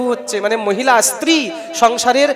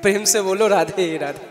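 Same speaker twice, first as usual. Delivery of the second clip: ordinary delivery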